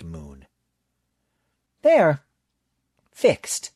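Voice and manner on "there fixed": a lightly raised, pleased voice for Bailey; satisfied Bailey. Acted. Bailey telling the librarian the job is done, satisfied. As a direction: offer it with a satisfied grin. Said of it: "Bailey offered, a satisfied grin".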